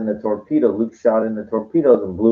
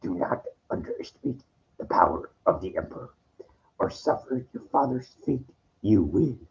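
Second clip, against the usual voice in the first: Yoda voice; 9 dB quieter than usual. Retold or imitated. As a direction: whisper like dying Yoda. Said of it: "He goes".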